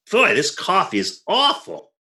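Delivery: ordinary talk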